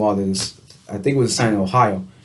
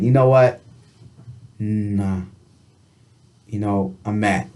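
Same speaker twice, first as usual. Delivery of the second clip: quietly mad; 3 semitones lower, slower than usual. Retold or imitated. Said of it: "And then mad. Like".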